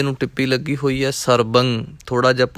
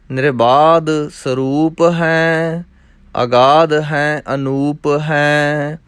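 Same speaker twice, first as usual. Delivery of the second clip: measured, rhythmic chanting of verses; scripture recitation; 4 semitones higher, 3 dB louder, much slower than usual. Acted. Teacher reciting scripture for students, rhythmic and measured. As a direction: recite each line as sacred text, evenly.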